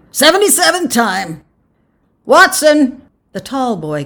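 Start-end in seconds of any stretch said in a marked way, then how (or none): 0.1-1.4 put-on loud, high old-timer; boasting old man. A narrator's reading. Old man going through the crowd, loud boast. 2.2-3.0 high, loud roll call. A narrator's reading. Official calls the next man forward, loud.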